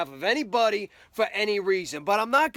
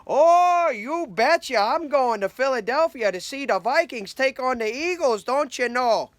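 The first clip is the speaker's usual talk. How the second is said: Minnesota-accent mock Vikings fan bragging; 3 semitones higher than usual. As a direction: ham up a cheery Minnesotan fan. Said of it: "couldn't wait to tell all your friends".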